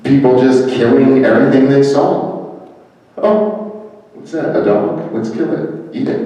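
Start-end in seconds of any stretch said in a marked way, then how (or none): 3.2-6.2 high, hushed mock voice, almost unvoiced; a hint of a gleeful, casual killer; mock careless killer. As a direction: lightly mimic a thoughtless hunter spotting a dog. Mimicking people killing animals.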